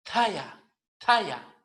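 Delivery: "Talla" with a puff of air after the T; breathy t-h starting "talla"; aspirated T. Shown as a demonstration. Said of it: "Con la T H aspirada".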